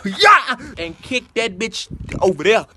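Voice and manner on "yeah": sudden loud yell, pitched up; a short furious shout at a puppy; yell while kicking. Retold or imitated. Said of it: "just be like".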